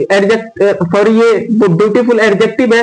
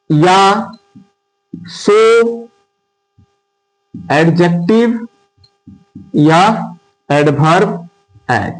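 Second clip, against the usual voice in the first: slow dictation; 3 semitones lower, much slower than usual. Shown as a demonstration. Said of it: "अगर positive degree इस form में हो".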